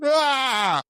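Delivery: high, loud imitated laugh burst; hammed-up impression of his laugh; mock laugh yelp. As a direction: squeal it out to tease him. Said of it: "all I heard was".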